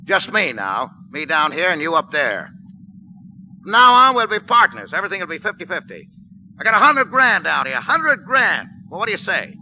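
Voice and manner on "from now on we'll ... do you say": loud, higher coaxing; wheedling offer. Acted. Trapped gangster coaxes soldier with money deal. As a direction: sweet-talk the soldier above.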